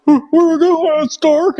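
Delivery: high, anxious lost recruit voice; mock panicked recruit. Recruit panicking on arrival at night, high and anxious. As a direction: play yourself as a scared, lost recruit.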